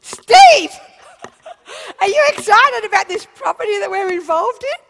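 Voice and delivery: loud, high thrilled gushing; gushing excitement. Retold or imitated. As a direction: gush about the property to the accountant. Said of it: "and I said to him".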